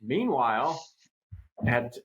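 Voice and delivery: normal talking voice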